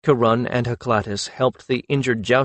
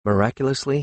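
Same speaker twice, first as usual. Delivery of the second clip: ordinary delivery, nothing marked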